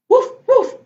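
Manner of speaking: high, loud mock woofs; high-pitched imitation barking; excited puppy bark. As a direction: yip twice like an eager puppy. Story reader barks as puppy Biscuit.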